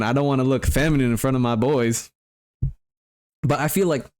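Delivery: ordinary talk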